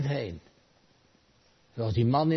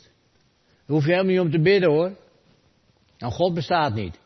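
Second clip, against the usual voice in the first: a louder, higher scoffing passer-by; scoffing atheist; 6 semitones higher, 6 dB louder than usual. Retold or imitated. Passer-by mocks the praying man, loud and scoffing. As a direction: call it through the open window with a sneer. Passing atheist calls in window.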